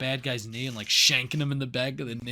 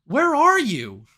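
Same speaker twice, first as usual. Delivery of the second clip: questions that climb higher and louder in disbelief; confused mock panic; 8 semitones higher, 6 dB louder than usual. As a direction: build the confused shouting toward the last question. Panicked questions inside the dream scene.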